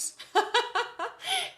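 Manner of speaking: high burst of real laughter; high amused giggle. Real, not acted. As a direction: laugh at how strange it is. Amused laugh at own story.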